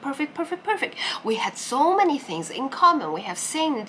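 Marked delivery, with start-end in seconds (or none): 0.0-0.9 gushing. Retold or imitated. Gushing about a perfect date.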